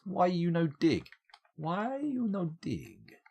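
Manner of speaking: mock pidgin plea. Pleading with idle miners.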